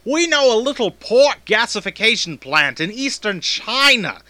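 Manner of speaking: smooth announcer read. Acted. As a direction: sell the product, polished. Voice actor reads ad copy.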